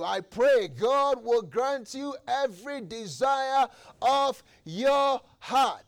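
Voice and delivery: usual speaking voice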